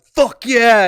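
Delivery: screamed high and loud, a burst of glee; retold scream. Caller screaming into the hotline phone after winning the bet, loud yell. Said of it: "they'll just scream into the phone".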